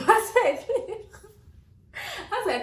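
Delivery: high-pitched, giggling through the words; laughing. Real, not acted. Woman recalling a stranger's kind remark, laughing. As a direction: crack up while trying to carry on the story.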